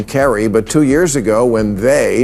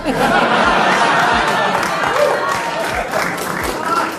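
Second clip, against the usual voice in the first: crowd laughter. Real, not acted. Audience laughs at debt joke.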